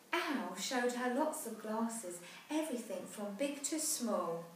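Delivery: put-on lively rhyming read-aloud for children; storybook narration. A narrator's reading. Parent reading a rhyming picture book aloud, lively. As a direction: tell the rhyme brightly to a child.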